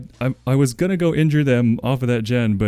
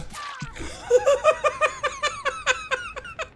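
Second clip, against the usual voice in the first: very high squealing laugh. Real, not acted. Streamer laughing at game.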